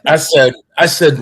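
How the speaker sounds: ordinary talk, nothing marked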